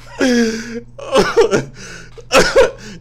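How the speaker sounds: a long high laugh bursting out; burst of laughter. Real, not acted. Reacting to a wild song, a long high laugh. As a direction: crack up at the song.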